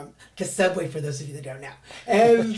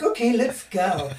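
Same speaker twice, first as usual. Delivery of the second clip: put-on story voice; slower than usual. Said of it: "that's not the way we talk, but that's the way I talk in the story".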